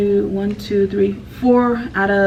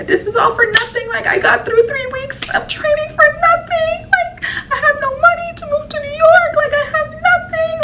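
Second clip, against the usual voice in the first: pitched way up, imitating a teary wail with a laugh; retold crying wail. Packing her room, telling her roommate she has nothing, imitated sob. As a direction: replay the breakdown as a high, half-laughing sob. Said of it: "I'm crying".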